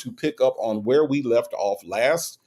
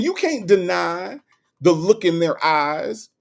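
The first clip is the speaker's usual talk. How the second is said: normal talking voice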